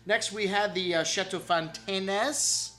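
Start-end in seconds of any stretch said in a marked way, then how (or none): 1.4-2.7 Fontaines with the last E sounded out, drawn out slowly; accented final E. Real, not acted. Wine reviewer naming the next chateau, Fontaines with accented E. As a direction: stretch Fontaines, voicing the accented E at the end. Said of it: "Accent on the E".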